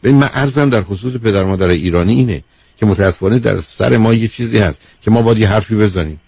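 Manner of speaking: usual speaking voice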